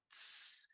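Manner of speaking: short voiceless hiss; snake hiss. Acted. The mouse comes across the snake, hissed.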